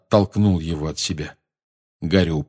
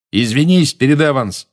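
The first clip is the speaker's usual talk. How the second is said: raised voice, higher and louder; yelling; 6 semitones higher, 5 dB louder than usual. A narrator's reading. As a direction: bellow it as an order. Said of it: "заорал Джеймс".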